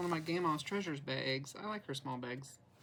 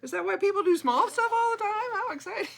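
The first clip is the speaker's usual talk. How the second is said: mock excitement; 14 semitones higher, 10 dB louder than usual. Mock amazement at small stitching progress.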